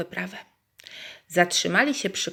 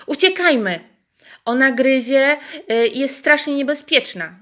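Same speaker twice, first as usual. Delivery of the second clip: higher and louder, alarmed; frightened animals crying out, louder; panicked calling out; 7 semitones higher, 7 dB louder than usual. Acted. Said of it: "zawołały zwierzęta".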